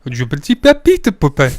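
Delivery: mock schoolboy French; foreign accent in French. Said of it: "try to speak French".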